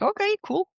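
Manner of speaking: bright high-pitched agreement; high chirpy okay. Retold or imitated. To her boyfriend, agreeing in a high chirpy voice. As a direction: pitch jumps way up on okay cool.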